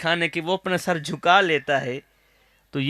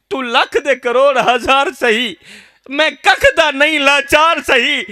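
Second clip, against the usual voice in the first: higher and louder, 'لکھ' and 'ککھ' hit hard; crying out in grief; 8 semitones higher, 7 dB louder than usual. Acted. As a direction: belt the couplet out in anguish. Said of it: "درد بھرے لہجے میں کہہ رہا ہے".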